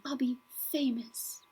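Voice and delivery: soft whisper to herself; whispered dream. Acted. Teacher imagines fame, whispering to herself. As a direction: breathe it like a secret wish.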